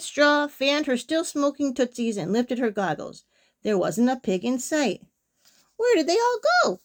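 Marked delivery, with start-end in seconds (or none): 5.8-6.8 high-pitched, exclaimed demand; mild storybook shout, pitched way up; shouted question. A narrator's reading. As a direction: call it out to someone, exasperated. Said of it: "Gritch shouted to a duck".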